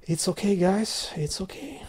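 whispered to himself; calm yourself in a whisper. Real, not acted. Calming himself after rant.

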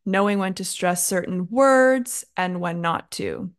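"words" emphasized above the rest of the phrase; stress on WORDS. Shown as a demonstration. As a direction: demonstrate word stress by leaning on "words". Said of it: "I stressed the word words".

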